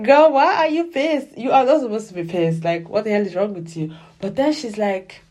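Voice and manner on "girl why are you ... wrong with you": put-on high, loud, incredulous scolding of the girl; loud disbelieving scold to a drama character; exasperated outburst. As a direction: throw up your hands at her, loud and high.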